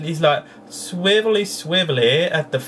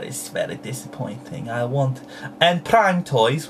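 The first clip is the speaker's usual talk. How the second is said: ordinary talk, nothing marked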